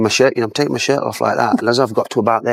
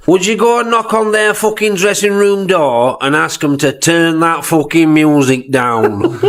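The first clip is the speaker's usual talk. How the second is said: imitating Clough; 7 semitones higher than usual. Clough's order retold.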